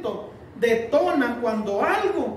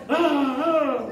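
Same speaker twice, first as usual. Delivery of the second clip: two short louder growls, like a snarling dog; 4 semitones higher, 4 dB louder than usual. Shown as a demonstration. Preacher imitating a snarling dog to show rage, growling. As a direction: growl twice like a dog baring teeth. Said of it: "¿has visto al perrito cómo se pone?".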